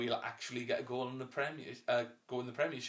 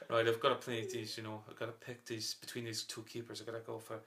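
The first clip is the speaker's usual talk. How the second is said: a softer, lightly voiced football manager; bad manager impression; 3 semitones lower, 6 dB quieter than usual. Podcast host voicing a manager choosing keepers, lightly and softer. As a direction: mumble it like a weary manager picking his team. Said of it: "my bad Brendan Rodgers".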